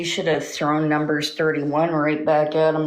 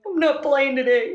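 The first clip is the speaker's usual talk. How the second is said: higher, almost crying with laughter; laughing harder; 9 semitones higher than usual. Real, not acted. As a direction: repeat it, breaking up into laughter.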